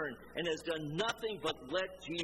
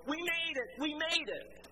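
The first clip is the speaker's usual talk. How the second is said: mock triumphant yell; 11 semitones higher than usual. Movie survivor celebrating as they drive off, mock triumphant yell. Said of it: "Franco yells".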